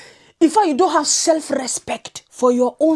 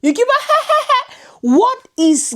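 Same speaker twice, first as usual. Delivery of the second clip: high, slow mock laughter; cackling imitation of her laugh; 11 semitones higher, much slower than usual.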